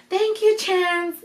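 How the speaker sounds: high, drawn-out thanks to a pet; cooing thanks. Retold or imitated. Dad thanks the little dog, cooing in a high voice. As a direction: imitate dad sweetly thanking his dog.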